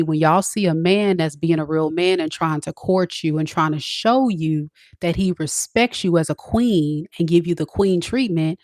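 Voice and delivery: ordinary talk, nothing marked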